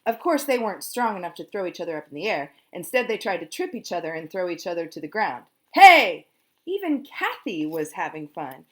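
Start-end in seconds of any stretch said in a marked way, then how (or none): 5.7-6.2 loud high yell. A narrator's reading. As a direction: shout it on the beat. Dance shout.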